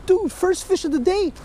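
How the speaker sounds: high, thrilled squeals at the catch; can't believe it's a trout; real excitement. Real, not acted. Angler thinks he hooked a trout, high excited squeal. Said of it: "I was excited for nothing".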